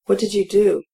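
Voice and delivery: plain reading voice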